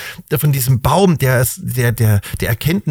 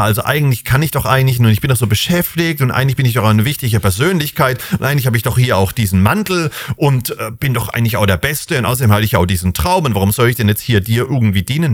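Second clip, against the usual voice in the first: hurried, self-important babbling; rushed excuses; faster than usual. Acted. Preacher voicing Josef dodging God's call, rushed excuses. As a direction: rattle off the excuses fast, one after another.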